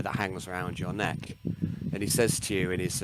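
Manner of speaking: normal talking voice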